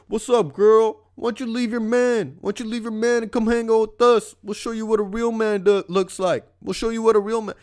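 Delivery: high-pitched mock catcaller voice; exaggerated impression of a street heckler; heckling hoodlum. Imitating hoodlums heckling a couple.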